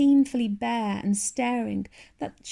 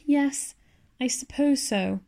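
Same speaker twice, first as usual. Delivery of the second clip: a hint of reluctant agreement, a little higher; 4 semitones higher, slower than usual. A narrator's reading. Matthew reluctantly agreeing with Marilla about the girl, hesitant. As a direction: give in without wanting to. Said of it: "said Matthew reluctantly".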